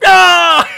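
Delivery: high, loud falling yell; mock scream. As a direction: scream like a cartoon crowd dropping. Mimics falling people after pun.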